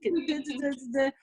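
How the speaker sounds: ordinary talk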